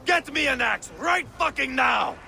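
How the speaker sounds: frantic yelled order; desperate shout. Acted. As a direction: bark it out in desperation. Desperate father demands an axe.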